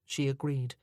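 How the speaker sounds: usual speaking voice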